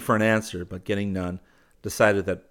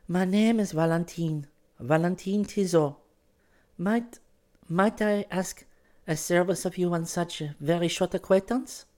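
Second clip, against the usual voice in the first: put-on high-pitched, halting girl's voice; grave young girl; 12 semitones higher than usual. A narrator's reading. Girl begs a service of a new acquaintance, gravely. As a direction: ask a favor gravely, high and hesitant. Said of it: "grave and a little pale".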